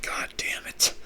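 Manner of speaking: whispered under the breath; whispered curse. Retold or imitated. Gang leader cursing at the map, whispered. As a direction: hiss it to yourself in defeat. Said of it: "He's like".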